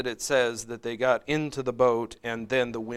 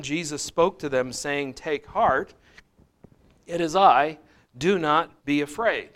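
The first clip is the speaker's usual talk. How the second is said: raised, measured Bible reading; slightly louder scripture reading voice; 3 semitones higher, 5 dB louder than usual. Acted. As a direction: read the passage out to the class. Reads scripture passage aloud.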